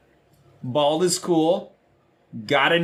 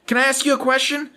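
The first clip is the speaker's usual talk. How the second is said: put-on higher, quick little-boy voice; cheeky little Johnny; 12 semitones higher, faster than usual. Johnny asking the teacher a question, high and quick. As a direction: pipe up like a sly kid. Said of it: "And he says".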